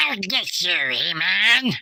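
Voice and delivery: a hint of a Skeletor sneer; Skeletor impression. Joking that the cast looks like Skeletor, light villain voice. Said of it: "Looks like Skeletor".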